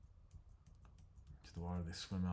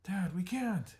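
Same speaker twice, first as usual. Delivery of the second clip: desperate cry; 12 semitones higher, 8 dB louder, slower than usual. Acted. Son answers chief.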